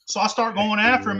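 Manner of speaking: ordinary talk, nothing marked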